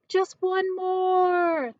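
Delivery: loud, high, slow drawn-out call. A narrator's reading. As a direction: stretch it out like a crowd begging. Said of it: "shoppers called out".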